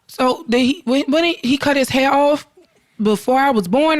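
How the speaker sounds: a hint of a child's questioning; curious young son. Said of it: "he was like".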